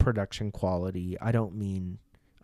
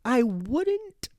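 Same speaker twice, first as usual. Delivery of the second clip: exaggerated Spanish accent, slow and stretched; much slower than usual. Shown as a demonstration. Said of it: "that's not how I talk".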